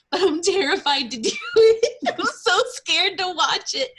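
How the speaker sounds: high, loud, laughing self-mockery; laughing at herself. Real, not acted. Admitting she's too scared to watch, laughing. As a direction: giggle through the confession of being scared. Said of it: "I'm such a baby".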